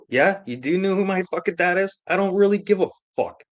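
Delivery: high-pitched defiant comeback; retold defiance. He answers the man who knows his dad, defiant retort.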